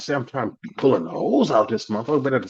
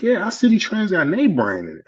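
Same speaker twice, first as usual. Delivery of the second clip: higher-pitched, confident retold pitch for the store; imitated eager assurance; 9 semitones higher than usual. Relative assures newcomer the store has brands, imitated eager assurance. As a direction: vouch for it in a lifted voice.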